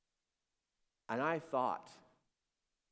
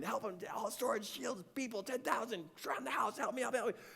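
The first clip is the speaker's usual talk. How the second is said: high-pitched pleading babble; acted-out panicky prayer; frantic repeated prayer; 10 semitones higher than usual. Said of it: "just praying over and over again".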